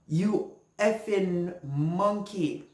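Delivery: put-on slow, contemptuous old lady sneer; slow sneering insult. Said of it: "And she was like".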